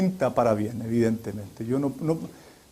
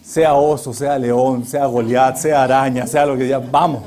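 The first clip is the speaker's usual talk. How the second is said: louder, hammed-up brave battle cry; mock bravado; 3 semitones lower, 6 dB louder than usual. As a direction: puff up like a hero going to war. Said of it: "yo fui todo valiente".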